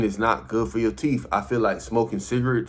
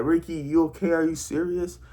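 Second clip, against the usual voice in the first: put-on higher-pitched voice of his worried girlfriend; 7 semitones higher than usual. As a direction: imitate her checking on him, much higher. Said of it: "My girl had to pick me up, like".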